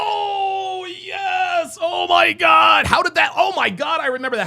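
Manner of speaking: high, loud drawn-out burst of sudden recall; real excited outcry as the memory hits; astonished recognition. Real, not acted. Host suddenly remembers a caller's girlfriend story, astonished.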